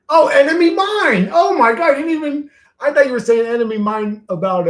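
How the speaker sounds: very high, loud excited outburst; real high-pitched surprise, easing as you explain; astonished realization. Real, not acted. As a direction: exclaim as the penny suddenly drops. Suddenly realizes which film was meant.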